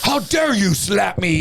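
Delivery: high, drawn-out outraged whine; mock indignation. Slapped man to his attacker, mock indignant. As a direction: imitate a slapped fool's slow, high outrage.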